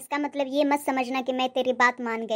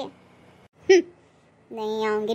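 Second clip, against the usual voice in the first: ordinary talk, nothing marked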